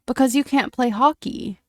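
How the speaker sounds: ordinary delivery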